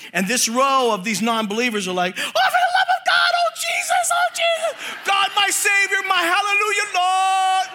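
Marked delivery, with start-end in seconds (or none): 2.2-7.7 high, shrieking cries of terror in a mock panic; panicked screaming. Terrified passengers screaming for Jesus, high shrieking yell. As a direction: shriek to God as the van swerves into traffic. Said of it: "they're screaming, yelling".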